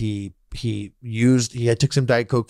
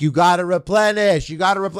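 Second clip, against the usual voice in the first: mock yelling parents; 6 semitones higher than usual. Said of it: "they're, like, yelling at him".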